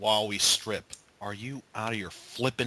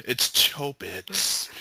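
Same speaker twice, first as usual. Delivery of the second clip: ordinary talk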